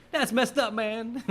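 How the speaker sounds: high, lightly voiced stoner; stoner drawl. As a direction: say it like a shocked stoner. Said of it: "not before Donnie says".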